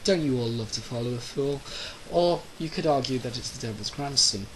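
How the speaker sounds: ordinary talk, nothing marked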